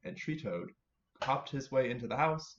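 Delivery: ordinary delivery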